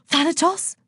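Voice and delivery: high-pitched gasp of alarm; gasped question. A narrator's reading. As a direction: gasp the name in dread. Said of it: "gasped Aphrodite".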